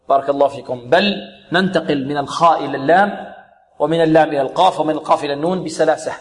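usual speaking voice

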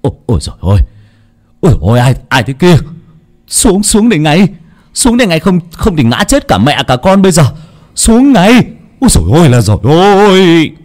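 high panicked shouting; frightened cry. Acted. Man shouting in panic at the ghost woman in the tree, high loud cry. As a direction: yell up at the tree in alarm. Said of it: "chú Dụng mới kêu lên".

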